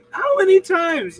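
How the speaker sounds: high, loud disbelief; incredulous. Real, not acted. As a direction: exclaim in disbelief.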